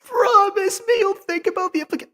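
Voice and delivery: high, theatrical mock delivery; dramatic character voice. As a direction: ham it up in a high voice. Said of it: "you get the idea with voices".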